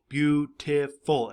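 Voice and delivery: "beautiful" slowly split into three beats; syllable by syllable. Shown as a demonstration. Teacher counting syllables for pupils, "beautiful" drawn out in parts. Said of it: "Every time your, your chin goes down, it's a syllable".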